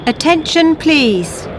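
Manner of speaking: usual speaking voice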